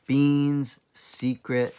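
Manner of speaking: ordinary delivery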